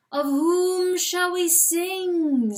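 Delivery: looming voice asking whom to sing. A narrator's reading. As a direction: intone it like a mocking chant. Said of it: "says the voice".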